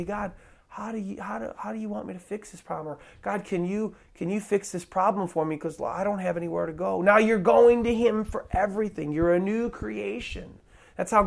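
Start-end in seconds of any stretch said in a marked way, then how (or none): 0.0-7.0 softer, quick aside to God; acted prayer. Believer asking God for help with a problem, quiet acted prayer. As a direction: voice a hushed, hurried plea.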